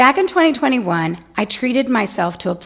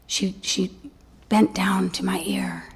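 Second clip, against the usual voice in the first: whisper; 3 semitones lower, 9 dB quieter than usual. Real, not acted. Whispered narration.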